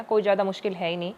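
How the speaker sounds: normal talking voice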